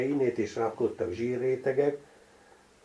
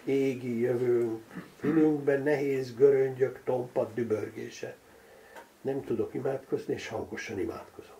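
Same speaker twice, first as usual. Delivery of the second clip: ordinary delivery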